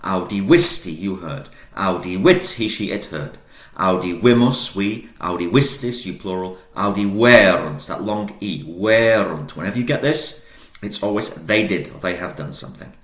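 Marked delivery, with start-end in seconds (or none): 0.0-9.6 call-and-response drill. Shown as a demonstration. Teacher leading students in a loud chant. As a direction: belt the Latin, murmur the meaning. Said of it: "let's do it again together, guys".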